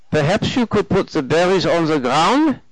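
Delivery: put-on German-accented, lilting up into a question. A narrator's reading. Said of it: "three months over from Germany".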